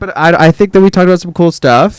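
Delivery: high, loud, cracking sign-off; excited. Real, not acted. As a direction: push it up high, almost yelling.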